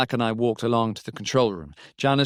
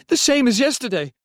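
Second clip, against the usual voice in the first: high, raised report down the phone; much higher, agitated voice; urgent call; 12 semitones higher, 5 dB louder than usual. A narrator's reading. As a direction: call it out in alarm. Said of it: "called Robbie".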